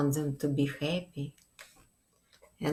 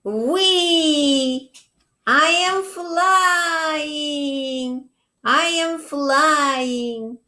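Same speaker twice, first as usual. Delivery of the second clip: high, slow, louder cry of joy; slow, high whoop of flying; joyful shout; 10 semitones higher, 5 dB louder, much slower than usual. Acted. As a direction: shout with delight at the wind lifting him. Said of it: "and he shouted".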